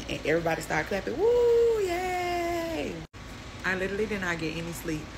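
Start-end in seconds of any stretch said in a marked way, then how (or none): 1.2-2.9 high, loud drawn-out "woo, yay"; cheer. Retold or imitated. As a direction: whoop it up like a cheering crowd. Everybody clapping and cheering.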